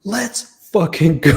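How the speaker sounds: loud, drawn-out acted demand; exasperated yell. Retold final demand to leave.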